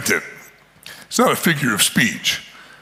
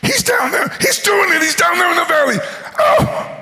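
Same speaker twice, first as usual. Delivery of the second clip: high, shouted frantic report; excited messenger. Acted. Messenger shouting to Eliab, frantic and high. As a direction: blurt it out in a panic. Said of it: "somebody comes to you and goes".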